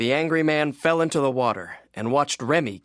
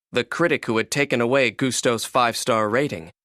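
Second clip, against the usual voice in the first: usual speaking voice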